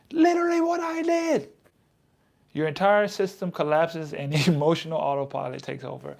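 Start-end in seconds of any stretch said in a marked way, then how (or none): 0.1-1.5 much higher sung-out confession; high wailing admission; squeaky admission. Real, not acted. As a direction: squeak it out in guilty disbelief. Admits the mistake.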